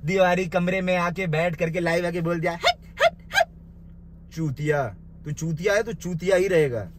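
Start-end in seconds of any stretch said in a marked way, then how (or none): 2.5-3.5 very high, squeaky mock "हट, हट, हट"; mocking squeak. Ridiculing the rival's live video with a squeaky mock "हट". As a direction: squeak "हट" three times to ridicule him.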